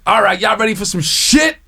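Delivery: hype host shout. Retold or imitated. Host Foolish pumping up the crowd, high shouted hype. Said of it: "And he goes".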